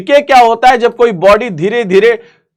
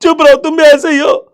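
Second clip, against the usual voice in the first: mock scolding; 7 semitones higher than usual. Grandparents scold the father.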